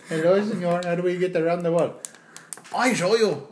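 high, loud caricatured Mexican voice; hammed-up Mexican accent, called out; mock Mexican accent. Joking about getting round the wall, mock Mexican accent.

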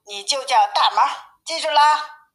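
lightly voiced storybook mother; mother bear. A narrator's reading. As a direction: instruct a little one, lifting the last words. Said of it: "说".

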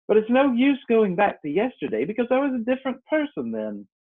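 ordinary delivery, nothing marked

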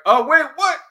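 high, loud startled outburst; surprised. Real, not acted. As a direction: react like he just vanished. Surprised host, guest drops.

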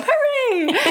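high-pitched imitation hooray; mock cheer. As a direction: squeal a teasing little hooray. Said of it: "she put her hands up".

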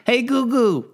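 put-on high, loud old lady voice; old grandma voice. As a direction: call out like a confused grandma. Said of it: "that fucking old grandma".

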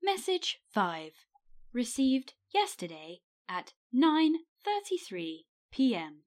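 stilted robotic voicemail announcer; word by word, with stepped machine pitch; answer phone voice. Acted. Answering machine reading out message times, robotic. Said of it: "Did you like my answer phone voice".

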